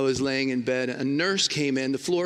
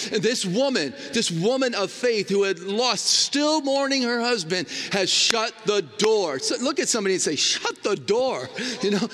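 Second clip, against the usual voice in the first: raised, fervent prayer and exhortation; fervent preaching; 5 semitones higher than usual. Acted. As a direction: preach it out with full conviction. Prayer and preaching at full force.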